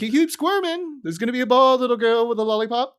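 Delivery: high put-on cartoon voice for the barber; mock the old barber warning the squirming girl; gruff barber. Barber teasing Lisa in a put-on high voice. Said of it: "And he's like".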